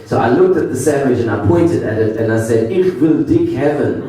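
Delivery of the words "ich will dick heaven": bad fake German accent; fumbling a German accent while ordering. Tourist ordering a sandwich from the desk clerk, bad German accent. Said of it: "what I thought was a German accent".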